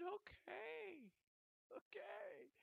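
very high, tearful voice; high weepy acceptance; retold tearful assent. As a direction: agree through imitated tears, pitched right up. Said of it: "I start to cry".

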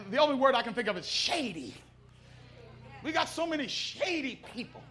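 ordinary delivery

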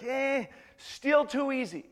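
high, drawn-out dismissive scoff; unimpressed. Acted. Elijah dismissing the soaked altar, unimpressed. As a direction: shrug it off slowly, pitched high.

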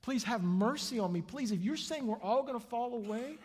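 rising, urgent prayer for mercy; desperate pleading. Acted. Acting disciples begging God on their knees.

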